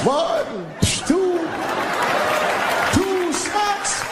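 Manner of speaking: very high shouted counting. Retold or imitated. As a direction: count the smacks like the Count. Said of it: "Count counting".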